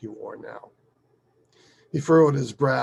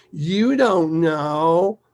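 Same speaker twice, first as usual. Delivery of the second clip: slow, louder, drawn-out defiance; mock stubborn child; 6 semitones higher, 9 dB louder, much slower than usual. Daughter stomping her foot at her father, stubborn. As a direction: imitate a little girl digging in. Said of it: "stomps her foot".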